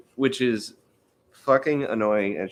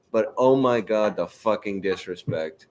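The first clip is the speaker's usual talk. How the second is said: ordinary talk, nothing marked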